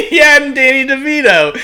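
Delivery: very high, loud laughing squeal; real hysterical laughter. Real, not acted. Reads out the confirmed absurd film.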